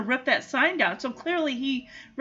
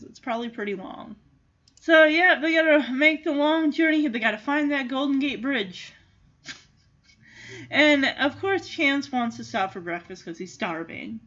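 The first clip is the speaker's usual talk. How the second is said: ordinary delivery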